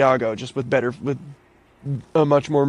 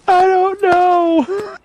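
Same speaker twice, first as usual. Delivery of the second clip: high, slow amused answer; dad's slow reply; 13 semitones higher, much slower than usual. Retold or imitated. Dad answering his son through laughter, slow and high. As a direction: drag it out, barely holding a laugh. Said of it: "He goes".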